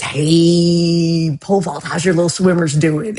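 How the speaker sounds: hammed-up doctor greeting, long held hey; mock jolly doctor. Imagined doctor greeting pole vault, mock chummy voice. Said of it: "oh, he says".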